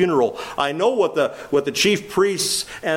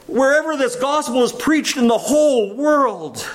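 preaching; 11 semitones higher than usual. Acted. Preaching at full force.

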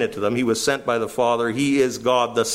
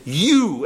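"you" pitched higher and punched; emphatic YOU; 4 semitones higher than usual. Shown as a demonstration. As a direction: hit "you" hard, as an accusation. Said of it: "and it's emphatic".

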